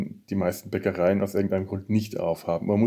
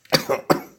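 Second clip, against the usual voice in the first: real sputtering cough after choking; coughing fit; 4 dB louder than usual. Real, not acted. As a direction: cough out a swallowed drink. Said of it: "ich hab mich verschluckt".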